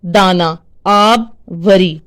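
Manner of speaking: examples drawn out slowly, each word spaced; slow demo. Shown as a demonstration. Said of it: "जो अल्फाज अपने वजन पर हों".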